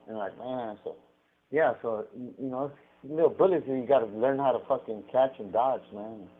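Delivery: ordinary delivery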